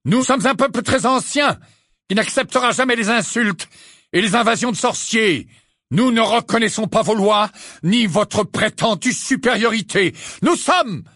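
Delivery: high, forceful centaur proclamation; proud defiance. Acted. Centaur rejecting the intruders' claimed superiority, proud and defiant.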